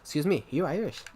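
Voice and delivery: retold question in a brisk Irish accent. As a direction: imitate an Irish girl, briskly. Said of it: "she was actually Irish herself".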